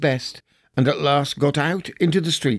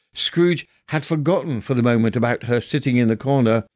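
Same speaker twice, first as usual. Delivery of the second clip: ordinary delivery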